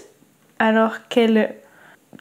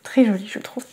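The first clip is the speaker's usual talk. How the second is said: breathy hush after the quote; soft whispered aside; 2 semitones lower, 4 dB quieter than usual. Real, not acted. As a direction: let it fall to a whisper, savouring the line. Soft reaction to a loved quote.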